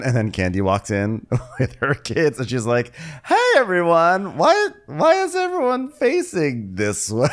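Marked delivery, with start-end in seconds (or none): 3.3-7.3 high, drawn-out baffled cry; exaggerated shrieky puzzlement; mock bewildered greeting. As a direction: imitate a confused guest walking in. Candy greeting room, baffled.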